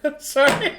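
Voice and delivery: high, loud apology breaking into laughter; laughing apology. Real, not acted. Game master losing it over a fumbled line, laughing. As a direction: apologize while cracking up. Cracks up over own slip.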